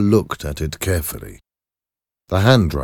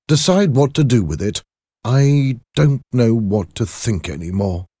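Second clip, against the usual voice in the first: troubled doctor; 6 semitones higher, 4 dB louder than usual. Acted. Voices Jekyll handing over the decision.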